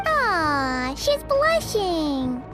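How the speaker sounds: low, drawn-out teasing coo. Acted. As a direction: draw it out to tease her. Teasing a companion who blushes.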